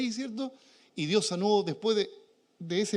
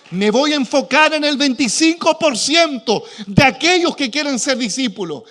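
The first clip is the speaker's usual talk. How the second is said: loud, fervent pulpit preaching; preaching at full force; 3 semitones higher, 12 dB louder than usual. Real, not acted. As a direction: proclaim it to the whole church. (predicación a todo pulmón)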